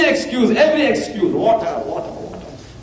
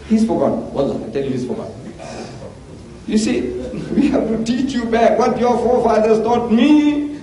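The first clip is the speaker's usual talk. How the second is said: ordinary talk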